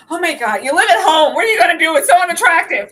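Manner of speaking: high, loud, hammed-up disbelief; mock outraged disbelief. Co-host ridiculing man living at home, loud mock outrage.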